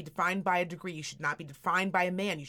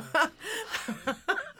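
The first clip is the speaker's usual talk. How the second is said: high laugh; 12 semitones higher than usual. Real, not acted. Laughing at daughter's line.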